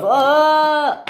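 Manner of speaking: long high, loud "ah"; high cry of alarm; acted scream. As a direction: wail as you swerve. Hits a large curve.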